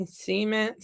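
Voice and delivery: quiet "cement," as SEE-ment; SEE-ment pronunciation. Retold or imitated. Counselor compares both words, quiet "cement," as SEE-ment. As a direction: test "cement," softly with a long ee. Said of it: "I said the word cement".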